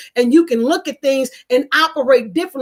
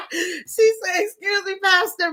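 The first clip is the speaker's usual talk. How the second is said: laughing; 6 semitones higher than usual. Real, not acted. Host rereading Catherine's funny comment, laughing. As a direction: reread it while still cracking up. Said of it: "that will always be funny to me".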